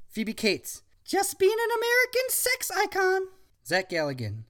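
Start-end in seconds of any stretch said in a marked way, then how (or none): 1.1-3.3 put-on much higher, sweet girl voice; young woman voice. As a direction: greet him like a sweet girl. Phoebe voiced.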